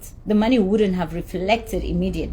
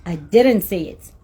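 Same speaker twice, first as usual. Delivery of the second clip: ordinary delivery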